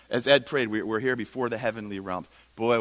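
ordinary delivery

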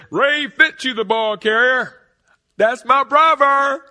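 booming high announcer call, stretched out; stadium announcer. Retold or imitated. Boy recalling the announcer calling his brother's name, booming. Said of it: "I hear the announcer say".

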